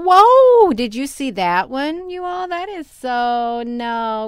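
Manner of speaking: high, loud burst of amazement; astonished outburst. Real, not acted. Vlogger asks viewers if they saw that, astonished shouting. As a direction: cry out to viewers, stretched and high. Sudden sighting during live commentary.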